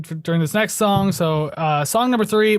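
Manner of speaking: ordinary talk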